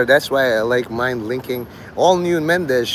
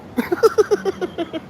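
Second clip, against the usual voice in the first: high squeaky laugh. Real, not acted. Laughs at own mockery.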